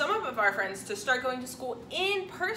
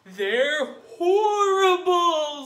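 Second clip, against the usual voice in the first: ominous maid; 4 semitones higher, 8 dB louder, much slower than usual. Acted. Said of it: "said the maid".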